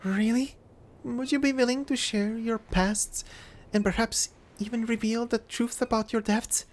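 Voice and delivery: put-on polite soft girl voice; soft young woman voice. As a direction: ask it gently and politely. [name] asks gently.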